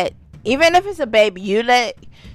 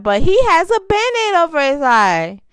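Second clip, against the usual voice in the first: imitating a child; 9 semitones higher, faster than usual. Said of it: "He was like".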